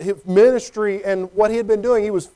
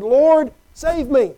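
high, loud plea for rescue; desperate cry; 5 semitones higher, 4 dB louder, much slower than usual. Acted. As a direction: call out like a sinking man. Peter sinking, crying out.

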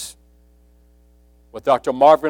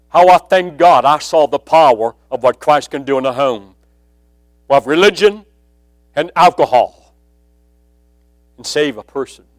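louder, thankful fervour; fervent preaching; 6 dB louder than usual. Acted. Preacher thanking God before the congregation, louder. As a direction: lift it up as praise.

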